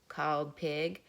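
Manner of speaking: ordinary delivery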